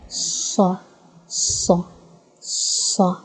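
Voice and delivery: "Sa" with a thick, heavy s; emphatic Arabic sad. Shown as a demonstration. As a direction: say "Sa" with a deep, gruff s, teeth closed. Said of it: "try again".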